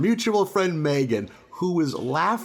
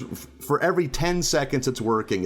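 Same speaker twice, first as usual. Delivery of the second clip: ordinary delivery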